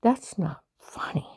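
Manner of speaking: hushed, voiceless whisper. Retold or imitated. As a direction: breathe it out with no voice at all. Retold reply to mocking agent.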